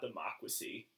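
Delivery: normal talking voice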